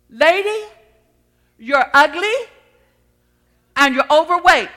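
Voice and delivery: loud, high parrot insult; rude parrot. Acted. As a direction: squawk it out as a rude bird. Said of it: "he didn't say it in a nice word that way".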